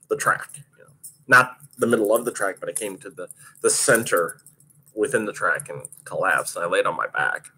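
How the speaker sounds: normal talking voice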